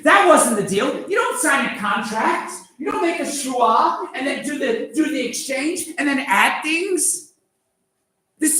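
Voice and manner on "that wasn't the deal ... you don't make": indignant outburst. Acted. Outraged objection, shout.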